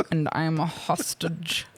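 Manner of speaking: badly faked American accent; mock American accent, hammed up; [name]. [name] posing as a hostage, terrible American accent. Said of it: "worst American accent".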